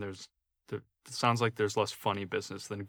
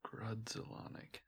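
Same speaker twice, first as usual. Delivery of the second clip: whispered sounding out; 20 dB quieter, slower than usual. Real, not acted. Sounding out the name.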